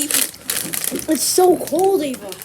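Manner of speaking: slow, shaky words through chattering teeth; shivering. Real, not acted. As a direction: drag it out, shaking with cold. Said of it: "I'm shivering so badly".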